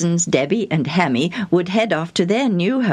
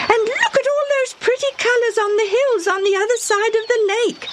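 put-on high, chatty girl voice; little girl Debbie. Young girl chattering to her friends, high excited voice. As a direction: gush like an excited small girl.

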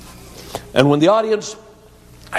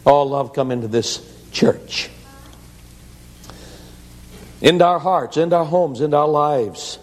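closing prayer; 4 semitones lower than usual. Acted. Pastor praying for the church at the close, low and earnest.

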